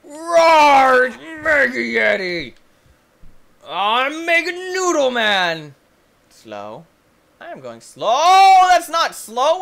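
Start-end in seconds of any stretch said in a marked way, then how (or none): 0.0-5.8 loud, high, stretched-out battle cry; mock monster cry. Player announcing himself as Mega Noodle Man, mock heroic yell. 8.1-9.6 loud, high outburst of protest; alarmed yell. Real, not acted. Player to the game as slow turns fast, alarmed yell.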